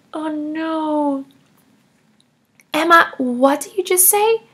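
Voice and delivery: ordinary talk, nothing marked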